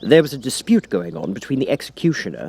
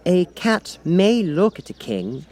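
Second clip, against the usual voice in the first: put-on higher, slow girlish voice; a young girl quoting a proverb; Alice voice; 8 semitones higher, slower than usual. Alice answers the King, girlish.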